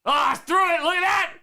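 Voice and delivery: loud, high shout of amazement; excited exclamation. Real, not acted. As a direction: yell out at a surprising throw. Excited at throw in footage.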